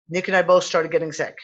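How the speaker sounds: ordinary talk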